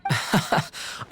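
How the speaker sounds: a brief airy chuckle; quiet breathy laugh; soft laugh. Acted. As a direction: chuckle softly before answering. Amused reaction in conversation.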